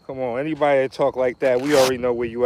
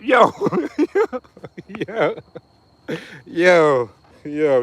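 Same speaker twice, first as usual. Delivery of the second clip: shouting out; 3 semitones higher, much slower than usual. Real, not acted. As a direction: holler Yo across the room. Calling out to a friend.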